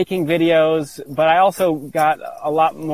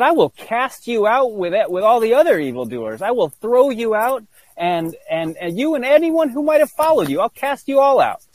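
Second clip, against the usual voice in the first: mild mock wrath; 7 semitones higher than usual. Retold being threatening him.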